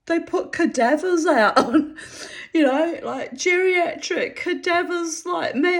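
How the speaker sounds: high, loud, amused rant; laughing. Real, not acted. Joking about Hollywood's thin stars, laughing. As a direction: keep talking as the laughter bubbles up. Still laughing at own joke.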